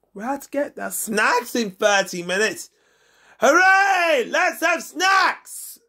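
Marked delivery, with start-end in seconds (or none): 3.4-5.8 excited cheer. Acted. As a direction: cheer for snacks. Snack time announced.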